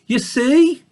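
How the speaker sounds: high, light piglet voice; young pig. Acted. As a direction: show himself like a curious piglet. Babe's voice.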